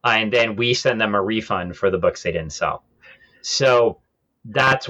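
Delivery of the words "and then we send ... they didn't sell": ordinary talk, nothing marked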